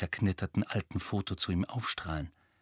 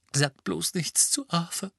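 choked out; 8 semitones higher than usual. A narrator's reading. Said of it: "würgte Misses Weasley jetzt hervor".

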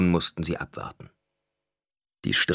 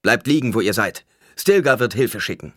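raised, louder order; commanding call; 5 semitones higher, 6 dB louder than usual. A narrator's reading. Paul tells the wounded to stay down, loud command. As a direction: call out instructions to a group.

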